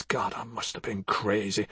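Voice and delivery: bitter whisper; despairing whispered aside. Acted. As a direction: breathe it out to yourself. Exhausted aside in quarrel.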